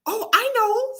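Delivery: put-on high-pitched eager little girl; bright idea. A narrator's reading. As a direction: pipe up excitedly with a plan. Salma has an idea.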